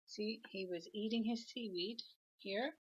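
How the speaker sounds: ordinary delivery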